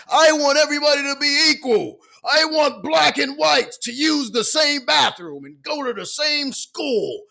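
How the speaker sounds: booming orator, high and drawn out; Martin Luther King. Acted. Acting out a civil rights speech to a crowd, shouted oratory. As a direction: project it slowly like a rousing public speech. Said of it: "Martin Luther King going to do a speech".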